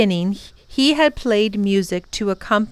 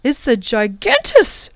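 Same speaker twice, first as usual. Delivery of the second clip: coughing; 4 semitones higher, 4 dB louder than usual. A narrator's reading. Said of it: "coughed the professor".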